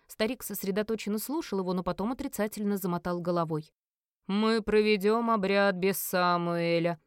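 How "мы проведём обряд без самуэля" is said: slow, imperious proclamation; announcing. Acted. Merlin announces the rite will go on, slow and imperious. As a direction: pronounce the decision with heavy authority. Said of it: "объявил он".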